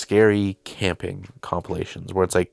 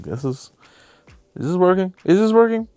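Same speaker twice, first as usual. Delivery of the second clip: rising panic; 11 semitones higher, 3 dB louder than usual. Acted. Acting the dragged victim on camera, building to frantic high-pitched panic. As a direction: pitch climbing higher and louder with each ask.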